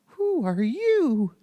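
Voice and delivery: high, slow, curious cartoon mouse; Mickey asking. A narrator's reading. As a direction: peer in and ask like Mickey. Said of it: "Mickey asked".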